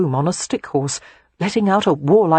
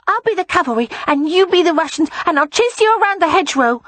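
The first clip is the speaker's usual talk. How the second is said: put-on high, loud child voice, speeding up; small boy bossily planning a game; eager little boy; 13 semitones higher, 4 dB louder, faster than usual. A narrator's reading. Boy sets up war game.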